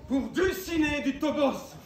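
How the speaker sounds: slow, loud knightly cry; battle cry. Acted. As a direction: cry her name to the skies.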